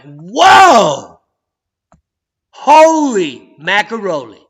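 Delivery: loud, slow, drawn-out amazement; amazed shout. Pretend. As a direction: shout it out like you can't believe it. Mock amazed reaction to satellites.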